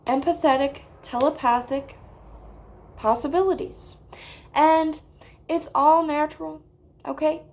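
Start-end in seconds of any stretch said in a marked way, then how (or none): none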